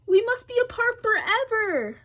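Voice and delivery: melodramatic. Acted. Melodramatic Gerald.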